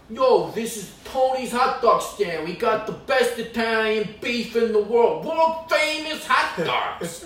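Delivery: loud, high, slow hawker's pitch; boastful stand owner. Acted. Comic as Tony boasting about the hot dog stand, loud slow hawking. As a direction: brag it out to the whole street, stretched and showy. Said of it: "all these fucking places are like".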